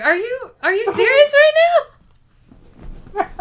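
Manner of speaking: hammed-up outraged cry; upset outburst. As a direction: play up the offended disbelief. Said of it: "I was so upset".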